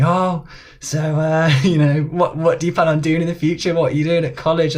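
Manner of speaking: lightly put-on nosy mum; imitated interrogating mum. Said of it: "interrogated me the whole way back".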